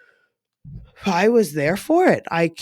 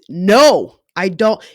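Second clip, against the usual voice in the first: retold loud protest; 8 dB louder, slower than usual. Retold protest during painful sex.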